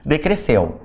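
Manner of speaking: normal talking voice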